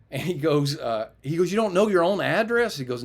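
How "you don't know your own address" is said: incredulous officer. Retold or imitated.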